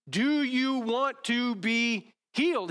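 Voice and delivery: slow, emphatic preaching question; ringing challenge. Acted. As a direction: call it out to the whole room.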